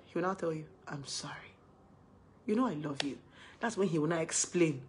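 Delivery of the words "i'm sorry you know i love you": very soft, lowered reassurance; tender apology. Retold or imitated. Boyfriend apologising to girlfriend once she calms, tender. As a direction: soothe quietly and apologetically.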